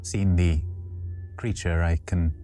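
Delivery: usual speaking voice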